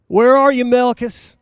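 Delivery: loud, high call into the crowd. Acted. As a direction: call out as if searching the room for Malchus. Preacher calling out for Malchus.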